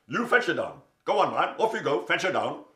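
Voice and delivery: loud, bossy lion telling elephant; a king giving orders; commanding lion. Acted. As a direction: order him off, booming.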